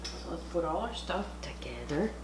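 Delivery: hushed whisper to the camera; soft whispered aside. Real, not acted. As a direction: whisper it like a secret. Whispering while assembling food.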